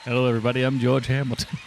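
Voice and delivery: a lightly voiced suave Hollywood actor; a hint of a smooth leading man taking the stage; George Hamilton impression. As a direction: ham up a smooth movie-star greeting. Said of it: "That's a spot on, spot on, Mister Hamilton".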